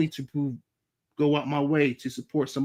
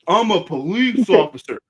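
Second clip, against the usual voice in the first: louder, higher mock officer; officer's announcement; 5 semitones higher, 8 dB louder than usual. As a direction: act out a cop announcing himself. Acting out officer announcing himself.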